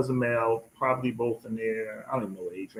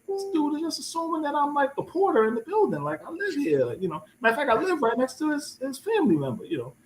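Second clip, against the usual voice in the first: much higher, affronted protest; indignant retort; 14 semitones higher than usual. Retold or imitated. Man retelling his protest about the older visitor, indignant and much higher. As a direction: bristle at the assumption, I live here. Retold indignation at being taken for porter.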